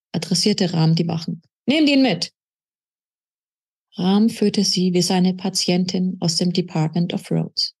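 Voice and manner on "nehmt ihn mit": sharp order. Acted. Rahm orders guards.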